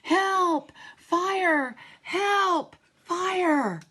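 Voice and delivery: high, loud cries for help; shouting for help. Acted. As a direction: yell it out in alarm. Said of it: "we heard somebody shouting".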